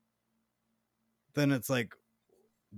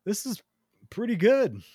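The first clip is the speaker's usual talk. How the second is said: mock enthusiasm; 7 semitones higher, 3 dB louder than usual. Friend faking enthusiasm for ramen.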